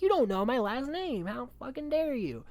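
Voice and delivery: high, loud mock-offended girlfriend; mock outrage. [name] scolding Roddy.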